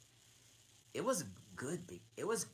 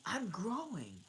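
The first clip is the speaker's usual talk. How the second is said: higher, lightly voiced meek girl; meek Alice; 10 semitones higher, 3 dB louder than usual. Alice answering the dormouse, meek and higher. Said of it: "said Alice very meekly".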